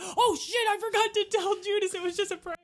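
high, loud oh-no voice; panicked God. Retold or imitated. God realizing he forgot to tell Judas, panicked. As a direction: squeal it like you just messed up badly. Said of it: "And he's like".

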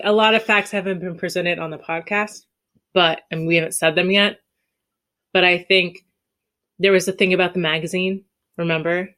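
normal talking voice

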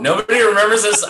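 can't believe nobody remembers; indignant disbelief at friends; incredulous. Real, not acted.

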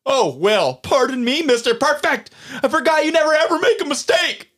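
high, mounting sarcastic outburst; indignant mock apology; sarcastic anger. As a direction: throw it back bitterly, pitch climbing. Sarcastic retort in quarrel.

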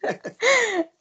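squealing laugh of delight; high excited laugh. Real, not acted. Thrilled laughing at the interview.

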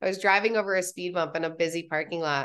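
ordinary talk

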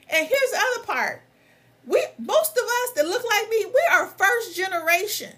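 high-pitched, exasperated outburst; real pissed-off rant. Real, not acted. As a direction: demand answers, pitch climbing as you go. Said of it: "I'm so pissed with this".

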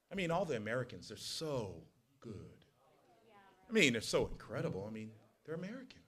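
soft, sarcastic praise of how good we are; mock praise. Preacher mocking the congregation's pride, softly sarcastic. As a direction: pretend to admire them, dripping irony.

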